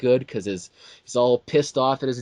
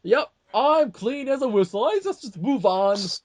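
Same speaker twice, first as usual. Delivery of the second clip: nonchalant action hero, pitched much higher; mock tough guy; 9 semitones higher than usual. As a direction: shrug it off like an unbreakable hero. Mocking McClane shrugging off injuries.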